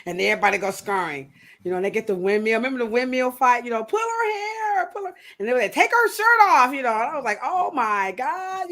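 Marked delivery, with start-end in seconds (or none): 3.8-5.1 mock egging-on. Kids egging on a windmill fight, mock urging. 5.7-6.7 high, loud goading cry; mock egging-on. Kids at a schoolyard fight, goading. 7.4-8.7 mock shock. Retelling own shock at the fight.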